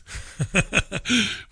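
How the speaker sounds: soft, rapid chuckle. Real, not acted. Host laughing at his own silly moobs joke, chuckling.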